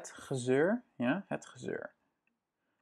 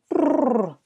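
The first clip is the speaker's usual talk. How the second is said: shivering brrr; 11 semitones higher, 7 dB louder than usual. Pretend. Shivering sound for cold.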